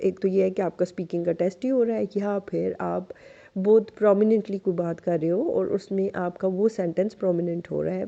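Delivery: ordinary delivery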